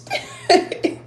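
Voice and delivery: high, loud laugh at herself; high burst of laughter. Real, not acted. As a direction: laugh at your own joke. Laughing at own age joke.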